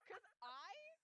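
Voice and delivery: laughing. Real, not acted. Listener laughing.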